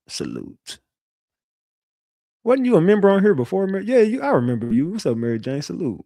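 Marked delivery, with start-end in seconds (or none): none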